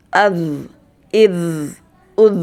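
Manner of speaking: az, iz, uz each ending in a steady z; no bounce on the final z; az, iz, uz, z held and smooth; vowel plus z drill. Shown as a demonstration. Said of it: "Tidak boleh mantul ya".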